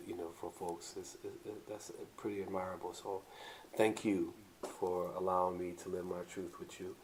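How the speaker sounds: low, soft, smooth talk; lightly done bedroom voice; sexy voice. Acted. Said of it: "Was that your sexy voice?".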